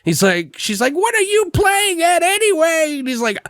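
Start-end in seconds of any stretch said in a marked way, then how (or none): 0.9-3.0 very high-pitched mock protest; hammed-up high squealed question; mock indignant woman. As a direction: imitate an upset woman in falsetto. Woman confronts hustler in retold scene.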